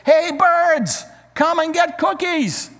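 high, loud call to the birds; loud shout. Acted. Frog shouting outside to the birds to come, loud and high. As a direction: shout it out across the yard. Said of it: "shouted in a loud voice".

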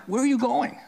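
a high, startled "where are you going"; the wife catching him at the door, alarmed; startled question. Retold or imitated. Wife alarmed, husband leaving.

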